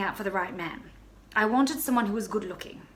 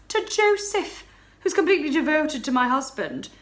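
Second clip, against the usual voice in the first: high, loud, breathless alarm; rising panic as the danger hits; mounting panic; 6 semitones higher, 7 dB louder than usual. Acted. Baroness reliving her fright to her friend, high and loud. Panic as stranger enters building.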